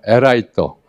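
에라이토 said the Japanese way, with 에 instead of 왜; Japanese-accented 에라이토, lightly done; Japanese accent Korean. Shown as a demonstration. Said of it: "일본인들은 왜 발음이 안 돼서".

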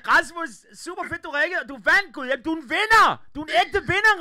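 loud, high-pitched cheering; excited congratulations. Real, not acted. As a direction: hype up the winner at full volume. Host congratulates prize winner on phone.

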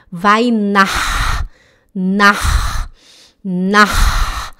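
"nach" with a long rasped ch; throat ch. Shown as a demonstration. Teacher locates the sound for students, "nach" with long ch. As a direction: hold the airy scrape at the end of "nach". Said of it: "É aqui".

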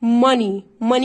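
ماني as "MUH-nee"; teacher modelling "MA-nee"; correct pronunciation. Shown as a demonstration. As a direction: open short vowel, no o, in ماني. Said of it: "احنا بنقولها ماني".

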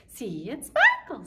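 sparkle sung up high and loud. Shown as a demonstration. Teacher going high on the rhyme for kids. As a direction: lift your voice high on "sparkle". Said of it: "where my voice went high".

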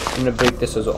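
ordinary delivery

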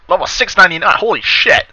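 loud shocked swearing; shocked outburst. Real, not acted. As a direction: blurt it out in disbelief. Shocked at boss level.

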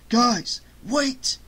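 high, urgent warning; high-pitched alert, hissed at friends. A narrator's reading. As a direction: get their attention urgently. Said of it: "he hissed".

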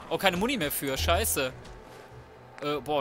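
normal talking voice

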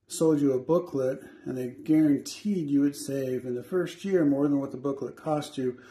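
ordinary talk

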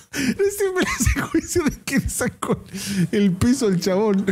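words squeezed out through high laughter; laughing while talking. Real, not acted. Streamer cracking up at a gym prank video, laughing. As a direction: laugh through the whole line.

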